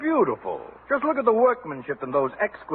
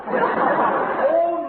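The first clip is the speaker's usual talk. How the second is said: amused recognition; 13 semitones higher, 4 dB louder than usual. Acted. Clerk recognises the ugly item.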